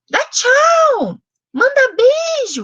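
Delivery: put-on high, sing-song command to a baby; comando para bebê. Shown as a demonstration. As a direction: call it out high and playful, as to a baby. Models commands given to baby. (falando com bebê, agudo e animado)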